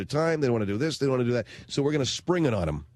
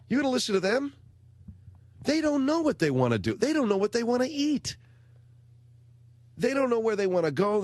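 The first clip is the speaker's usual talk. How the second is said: worked-up rant; 8 semitones higher than usual. Real, not acted. Ranting about kids' wishes.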